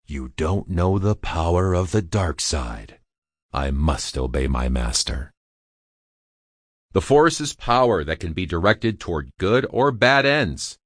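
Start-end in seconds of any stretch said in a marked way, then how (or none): 0.1-5.3 deep, dark, menacing villain voice; lightly voiced Darth Vader, low and cold. Narrator voicing Vader's lines.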